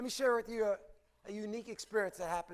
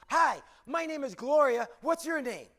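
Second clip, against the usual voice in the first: bright, higher-pitched chirpy greeting; over-caffeinated cheer; 4 semitones higher, 6 dB louder than usual. Acted. Counter clerk greeting a regular customer, over-caffeinated and perky. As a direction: greet a customer with too much pep. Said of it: "she had too much coffee".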